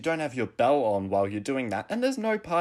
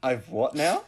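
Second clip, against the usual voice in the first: mock surprise; 3 semitones higher, 3 dB louder than usual. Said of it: "And I was like".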